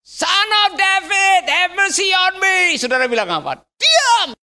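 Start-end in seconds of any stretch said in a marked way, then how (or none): 0.0-2.8 high, drawn-out shout for mercy; crying out. Acted. Preacher playing Bartimeus before a crowd, high drawn-out cry. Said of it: "anggap aja sekarang saya Bartimeus". 3.8-4.4 high, sudden angry command; angry shout. Acted. Crowd silencing the blind beggar, angry high shout.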